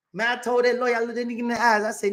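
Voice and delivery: ordinary talk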